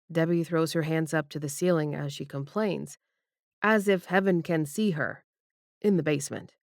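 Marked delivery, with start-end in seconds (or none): none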